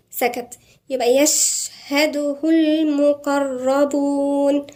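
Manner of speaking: يشهده with a breathy shin, slow; whispered shin. Shown as a demonstration. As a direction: let air escape on the shin of يشهده. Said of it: "الشين دي همس".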